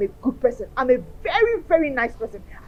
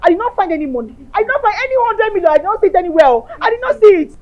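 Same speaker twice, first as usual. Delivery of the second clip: desperate loud insisting; 3 semitones higher, 6 dB louder than usual. Acted. Desperate insistence about the money.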